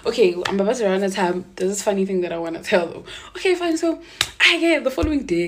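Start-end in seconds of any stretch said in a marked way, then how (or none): none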